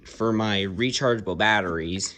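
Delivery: usual speaking voice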